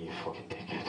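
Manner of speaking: whispered, low contempt; hushed scornful aside; whispered insult. Retold or imitated. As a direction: breathe the insult under your breath. Guys' reaction muttered.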